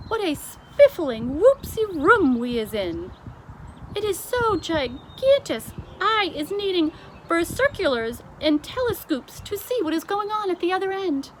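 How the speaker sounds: high, loud wondering giant voice; BFG in wonder. A narrator's reading. The giant marvels at the ballroom, loud and high. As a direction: marvel at the huge room. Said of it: "he cried".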